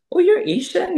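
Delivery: high, loud mock surprise; surprised. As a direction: imitate someone astonished, voice shooting up. Retelling pupil's surprised reaction. (natingala, taas ug tingog)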